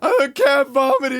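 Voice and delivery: loud, high-pitched hysterical wail; hammed-up uncontrollable sobbing; mock sobbing. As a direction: imitate a dramatic roommate wailing in panic. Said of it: "she's, like, sobbing".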